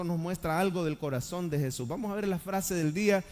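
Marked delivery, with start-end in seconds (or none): none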